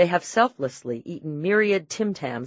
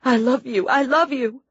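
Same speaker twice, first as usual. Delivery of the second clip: desperate plea; 9 semitones higher, 4 dB louder than usual. A narrator's reading. Wife begs dying husband.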